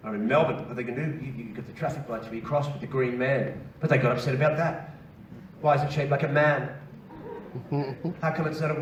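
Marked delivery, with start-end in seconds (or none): none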